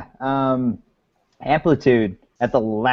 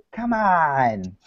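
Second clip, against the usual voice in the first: ordinary talk, nothing marked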